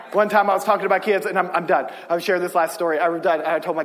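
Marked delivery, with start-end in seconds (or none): none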